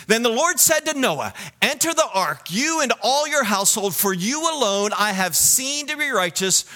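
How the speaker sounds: high, emphatic scripture proclamation; ringing, high sermon delivery; forceful preaching. Real, not acted.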